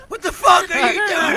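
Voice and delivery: high, loud imitation of a furious ex; outraged yell. Ex catching him running out, outraged mock yell. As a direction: holler it after someone running away. Ex screaming after him, retold.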